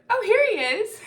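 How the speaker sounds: high, loud mock call-out; imitated excited discovery. Youth finding him down the hallway, imitated excited call-out.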